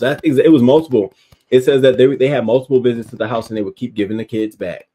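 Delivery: loud, indignant rant; outraged. Real, not acted. Ranting at social workers who kept returning the kids, loud and outraged. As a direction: blast the social workers for failing.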